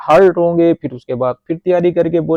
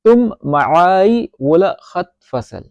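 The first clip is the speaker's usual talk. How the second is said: slow model sentence; 3 semitones higher, much slower than usual. Shown as a demonstration. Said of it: "تو اس کو Arabic میں بولیں گے".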